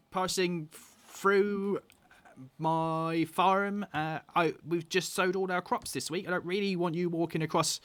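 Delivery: halfling woman politely objecting; halfling farm woman. Acted. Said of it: "a halfling woman".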